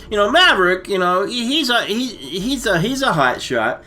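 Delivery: normal talking voice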